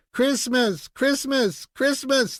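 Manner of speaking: gleeful high-pitched shout; excited shouting. A narrator's reading. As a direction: cheer it out like eager kids waking her. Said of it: "they all shouted".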